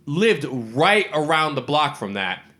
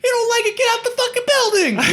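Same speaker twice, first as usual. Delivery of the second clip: very high, loud comic yell; squeaky mock yell; 4 dB louder than usual. Woody Harrelson yelling at his partner, very high comic yell. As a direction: shriek it at him through laughter. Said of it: "And he goes".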